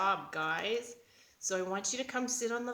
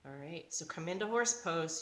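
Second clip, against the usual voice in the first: normal talking voice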